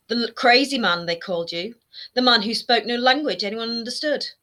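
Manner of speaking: mock him with relish; mother sneering at her son's past; wicked mockery. A narrator's reading. Mother mocking son wickedly.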